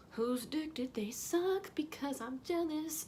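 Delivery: hushed, exaggerated envy; mock jealousy. Jealous aside about girls near stage.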